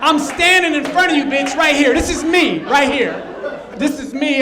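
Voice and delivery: loud, frustrated insistence; exasperated shout. Acted. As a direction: make her see you're standing right there. Arguing with wife, exasperated.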